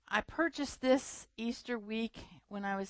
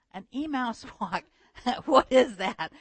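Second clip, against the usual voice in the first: puzzled, laughing; 2 semitones higher, 5 dB louder than usual. Retold or imitated. Puzzled retreatants quoted.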